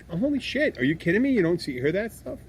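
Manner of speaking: high-pitched, incredulous outburst; mock-outraged and exasperated; astonished disbelief. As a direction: react as if floored by what you heard. Acted astonished reaction to the accent.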